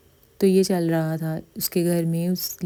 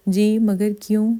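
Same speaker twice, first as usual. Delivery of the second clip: raised, slowed, apprehensive question; timid questioning; 4 semitones higher, 5 dB louder, slower than usual. A narrator's reading. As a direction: ask slowly and higher, a little afraid. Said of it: "تریم نے ڈرتے ہوئے سوال کیا تھا". (ڈرتے ہوئے سوال)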